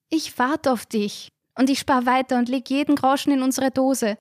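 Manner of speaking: put-on a girl promising to wait, firmly; Resi, resolute. A narrator's reading. Resi voiced, firm resolve. (entschlossene junge Frau, höhere Stimme)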